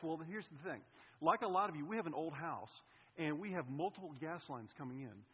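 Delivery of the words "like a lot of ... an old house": normal talking voice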